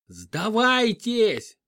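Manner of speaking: hoarse, drawn-out yell; hoarse shout. A narrator's reading. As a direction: bellow it out hoarsely. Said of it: "хрипло заорал я". (хриплый крик)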